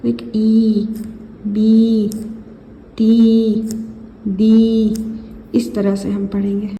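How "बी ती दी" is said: बी, ती, दी each louder with a held ई; drilling long ई sounds. Shown as a demonstration. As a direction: punch and stretch the ई in बी ती दी. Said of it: "ये मद्दा होगा".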